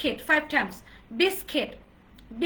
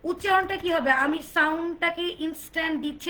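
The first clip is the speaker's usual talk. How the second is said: ordinary talk, nothing marked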